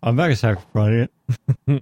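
fast, run-together muddled line; rushed fumble. Real, not acted. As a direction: blurt it out too quickly and trip over it. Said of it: "Let me try that again".

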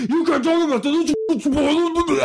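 high, whiny scolding imitation; mocking scold voice. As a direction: squeal like a hall monitor. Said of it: "And somebody's like".

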